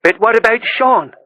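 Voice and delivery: lightly voiced village girl asking; a hint of a young girl. A narrator's reading. Girl's voice in story.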